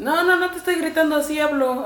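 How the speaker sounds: high, loud mock protest of girls insisting they aren't yelling; imitating the girls. Mimicking girls to his friends, high and loud. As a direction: squeal it like a girl swearing she's just talking. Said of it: "como las morras que dicen".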